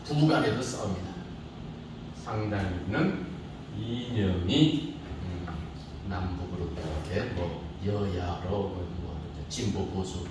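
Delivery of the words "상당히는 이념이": normal talking voice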